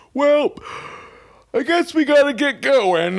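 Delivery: high, slow, exaggerated fake yawn; faking tiredness. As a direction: stretch it out like a big yawn. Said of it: "Listen to these acting chops".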